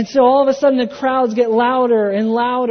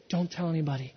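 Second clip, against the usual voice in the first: hushed warning; 4 semitones lower, 10 dB quieter, faster than usual. Retold or imitated. Jesus tells healed people to keep quiet.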